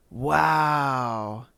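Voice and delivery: ordinary talk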